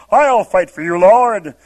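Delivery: heroic vow. Retold or imitated. Peter drawing his sword.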